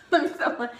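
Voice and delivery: breaking into laughter; laughing. Real, not acted. Laughing at her own joke to viewers, laughing. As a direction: laugh it off mid-sentence.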